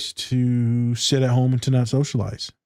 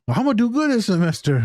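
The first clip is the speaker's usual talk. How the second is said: much higher relieved client; 9 semitones higher than usual. Retold or imitated. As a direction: chirp it like the happy client. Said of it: "quoting client".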